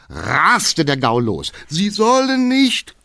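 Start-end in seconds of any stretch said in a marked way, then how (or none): 1.7-2.9 high, drawn-out cry from behind; shouted protest. A narrator's reading. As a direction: call out slowly and loudly in dismay. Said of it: "klang von hinten die Stimme des Geheimrates". (gedehnt und entsetzt rufend)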